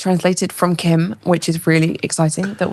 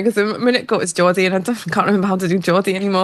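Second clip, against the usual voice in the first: ordinary talk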